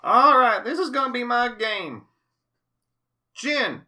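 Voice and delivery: put-on high, loud old man voice; sly old man winning. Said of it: "You got a good Bob voice".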